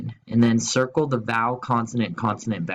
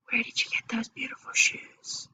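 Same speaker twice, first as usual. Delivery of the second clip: soft unvoiced whisper of a curious girl; Anna asks a friend in a whisper about her shoes; 12 semitones higher, 7 dB quieter than usual. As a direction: whisper it like a secret compliment. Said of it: "Anna is whispering".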